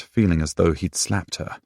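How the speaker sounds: plain reading voice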